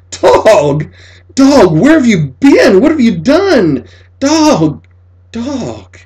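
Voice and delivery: put-on boy greeting his returned dog, high and loud; overjoyed cry. A narrator's reading. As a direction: shout the dog's name in wild joy.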